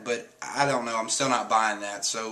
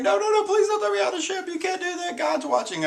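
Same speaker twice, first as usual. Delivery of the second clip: very high, rushed mock pleading; panicked begging; faster than usual. Caribou begging not to be thrown overboard, high mock panic. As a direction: squeal it out fast, begging for mercy.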